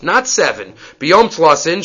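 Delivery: ordinary delivery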